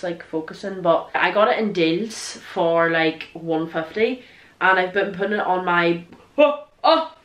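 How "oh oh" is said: sudden high, loud startled "oh, oh"; startled yelp. Real, not acted. Vlogger startled by a moth mid-sentence, yelping. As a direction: jump at a moth flying past. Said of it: "Sorry, it's a moth".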